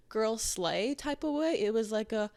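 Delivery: ordinary delivery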